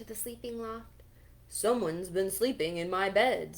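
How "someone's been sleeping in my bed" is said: louder, booming papa bear; great big voice. Acted. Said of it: "said Papa Bear in his great big voice".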